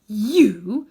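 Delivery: loud, menacing single word; a queen's thunder; terrible voice. A narrator's reading. Witch queen menacing Uncle Andrew in a terrible voice. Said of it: "in a still more terrible voice".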